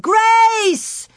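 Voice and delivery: very high, drawn-out scream. A narrator's reading. Laura seeing only wildflowers and grass, screaming for Grace. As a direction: scream the name across open ground. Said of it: "she screamed".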